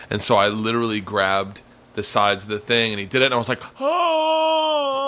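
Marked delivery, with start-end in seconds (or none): 3.8-5.1 long, high "oh" of pain; drawn-out high yelp, re-enacting pain; mock pained cry. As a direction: cry out as if the swab hurts.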